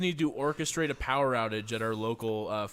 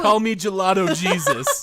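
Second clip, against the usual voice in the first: slow, much louder grand proclamation; mock savior; 6 semitones higher, 13 dB louder than usual. Friend hamming a grand messiah entrance, slow and booming. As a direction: announce yourself like a messiah.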